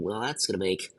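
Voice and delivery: usual speaking voice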